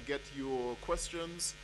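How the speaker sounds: ordinary talk, nothing marked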